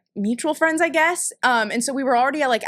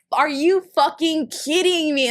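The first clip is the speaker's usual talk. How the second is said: higher, slow, drawn-out incredulous quote; mock disbelief; 4 semitones higher, slower than usual. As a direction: stretch it out, re-enacting exasperation. Retells frustration after fumbling.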